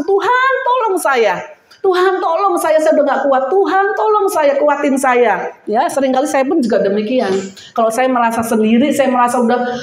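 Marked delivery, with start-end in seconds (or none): 0.0-5.5 high, pleading cry for help; crying out to God. Acted. A preacher acting a desperate prayer, high and pleading. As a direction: call out to God, begging. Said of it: "berseru sama Tuhan".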